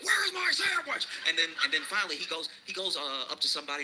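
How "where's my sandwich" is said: high, slow mock shout; angry demand. Angry customer shouts for his order, high mock yell. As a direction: yell it like a man fed up with waiting. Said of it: "He's like".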